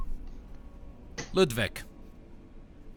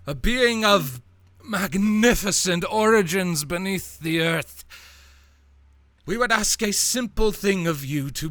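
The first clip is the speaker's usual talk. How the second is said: slow, grand, higher proclamation; self-important lead figure; 8 semitones higher, 6 dB louder, slower than usual. Acted. Lead figure addressing travelers on the road, grand proclamation. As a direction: address a crowd with pompous ceremony.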